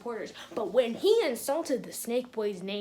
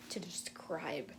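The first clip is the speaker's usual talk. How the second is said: whisper; 5 semitones lower, 8 dB quieter, slower than usual. Real, not acted. Overwhelmed, whispering.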